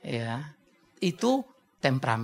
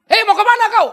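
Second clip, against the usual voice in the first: loud, high Batak hail; boisterous Medan Batak delivery; Batak speech; 13 semitones higher, 9 dB louder than usual. Shown as a demonstration. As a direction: greet like a Batak who sounds ready to fight. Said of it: "orang Batak ngomong, antara bercanda sama berkelahi bingung".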